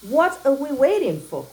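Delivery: lion eager to get going; eager cry. Acted. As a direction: cry it out, impatient to go eat. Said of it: "cried the lion".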